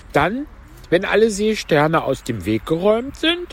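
high, plaintive turtle voice; Mock Turtle. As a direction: pitch it up for the tearful turtle. (hohe Stimme der falschen Schildkröte)

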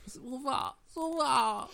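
high, mildly tearful cry; a touch of crying in a high boy's voice; weeping Pinocchio. Acted. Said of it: "rief Pinocchio weinend".

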